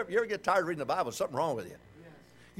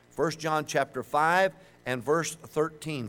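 usual speaking voice

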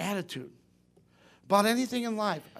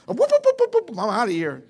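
mock alarm whoop; 4 semitones higher, 6 dB louder than usual. Fleeing bad-attitude people.

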